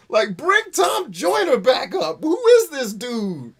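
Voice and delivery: high, loud jeering yell; mock heckle. Graduate yelling at the speaker to leave.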